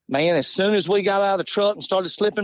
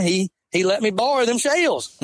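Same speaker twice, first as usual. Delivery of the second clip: ordinary talk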